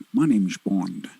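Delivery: low, suave spy introduction; James Bond. Acted. Co-host showing her the famous line, low Bond impression. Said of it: "could do a good James Bond impression".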